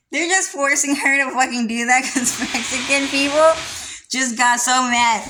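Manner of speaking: high laughing fit; laughing hard. Real, not acted. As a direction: crack up laughing. Laughing at mom's forced talk.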